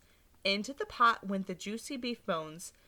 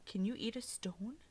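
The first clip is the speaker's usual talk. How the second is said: softer whispered question; 7 dB quieter than usual. Real, not acted. Teacher asks children.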